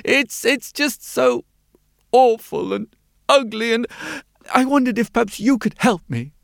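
high, halting, pleading character voice; troubled mole-like man. A narrator's reading. Mole-like man asking the glasses maker for help, pleading.

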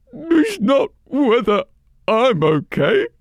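put-on high, loud, tearful old crone; weepy old woman. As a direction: cry it out like a grieving old woman.

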